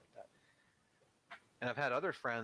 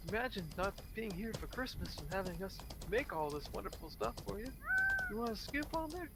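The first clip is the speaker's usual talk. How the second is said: pushy holiday host; 9 semitones higher than usual. Retold or imitated. Mocking a smug host pushing food on a guest, high and overbearing. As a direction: lay on the hospitality too thick.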